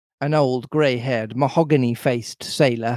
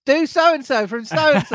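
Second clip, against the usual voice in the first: very high mock request; mimicking demands; 13 semitones higher than usual. Dialect coach parodying co-hosts' demands, high mock voice. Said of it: "And now it's like".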